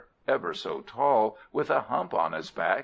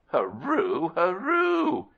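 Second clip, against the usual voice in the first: high, loud trumpeting shriek; rising to a piercing elephant cry; 10 semitones higher, 3 dB louder, slower than usual. A narrator's reading. Elephant laughing at being taken for a man, shrieking high and loud. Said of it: "he shrieked".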